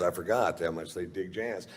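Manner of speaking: ordinary delivery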